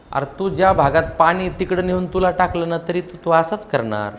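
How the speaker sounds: ordinary delivery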